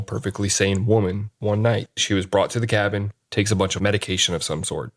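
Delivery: usual speaking voice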